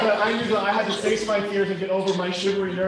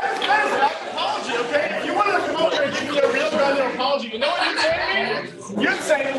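indignant demand; 6 semitones higher than usual. Acted. Demands a proper apology.

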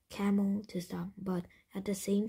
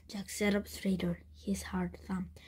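plain reading voice

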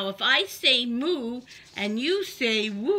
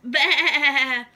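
bleating sheep voice; 8 dB louder than usual. Acted. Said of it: "said the sheep".

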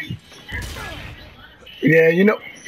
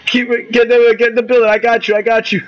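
shouting orders over battle; 7 semitones higher, 3 dB louder, faster than usual. Real, not acted.

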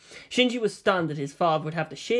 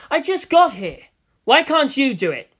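higher and louder, protesting; indignant protest; 8 semitones higher, 6 dB louder than usual. Acted. Shinji protesting to Misato, higher and louder. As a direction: push back hard.

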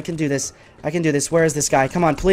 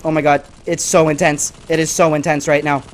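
ordinary talk

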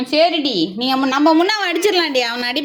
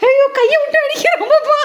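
much higher laughing squeal; 12 semitones higher, 3 dB louder than usual. Real, not acted. Owner squeals laughing as her pet strikes with its paw. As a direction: laugh it out in delighted disbelief.

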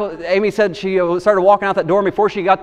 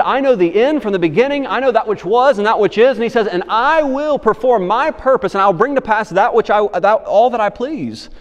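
preaching at full force; 3 semitones higher than usual. Acted. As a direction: proclaim it with power, stretching the lines. Voicing God's words preaching.

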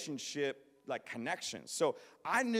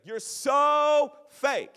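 拖长音的轻蔑冷嘲; slow, high, louder mocking sneer; 10 semitones higher, 11 dB louder, much slower than usual. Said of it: "He's like".

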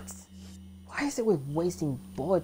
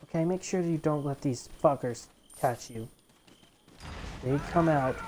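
usual speaking voice

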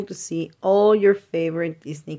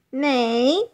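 higher pitched, playful horse whinny; horse neigh; 5 semitones higher than usual. Pretend. Reading an animal book to kids, a playful horse neigh.

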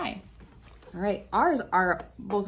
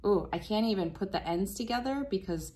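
normal talking voice

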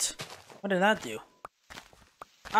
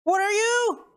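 startled question; 5 dB louder, slower than usual. Real, not acted. Meets a strange mob.

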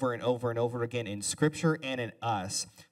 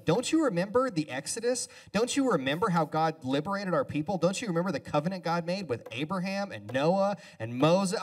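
higher, louder nagging reminder; harping prophets; 6 semitones higher, 4 dB louder than usual. Retold or imitated. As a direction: nag them like an old prophet. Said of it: "They were constantly saying".

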